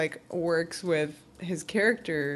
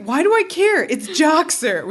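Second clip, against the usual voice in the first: higher, louder, slowed exasperation; mock exasperated Gabrielle; 9 semitones higher, 6 dB louder than usual. Podcast host voicing Gabrielle's jealous grumbling, mock exasperated. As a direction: huff it out like a fed-up friend. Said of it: "she's like".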